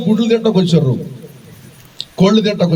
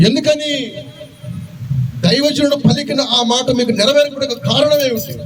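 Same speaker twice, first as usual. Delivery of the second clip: preaching at full force; 6 semitones higher, 3 dB quieter than usual. Acted. Preacher to his congregation, lifted preaching voice.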